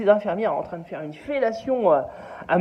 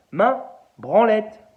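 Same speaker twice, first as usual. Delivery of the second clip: slow insistent command; 3 dB louder, much slower than usual. Acted. Man repeats command slowly.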